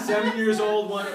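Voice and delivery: loud laughing high; big laugh. Real, not acted. Laughing at himself.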